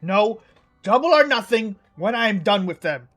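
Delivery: high, loud, daring shout; loud challenge. Acted. Twin shouting a double-or-nothing dare to the king. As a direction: bellow the wager.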